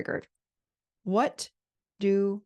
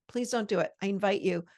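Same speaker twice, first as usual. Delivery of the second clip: normal talking voice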